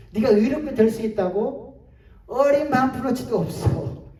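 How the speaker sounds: mock disbelief turning to dismissal; condemning devil. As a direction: jeer as the accusing devil. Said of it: "마귀가요, 이걸 가지고 우리를 정죄합니다".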